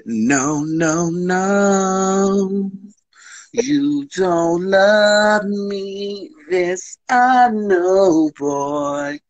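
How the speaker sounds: high, slow drawn-out sassy voice; high playful put-on voice; mock sassy retort. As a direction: sing it out teasingly, stretched. Mock scolding retold to partner.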